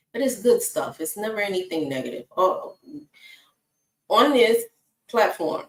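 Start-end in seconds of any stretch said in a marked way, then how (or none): none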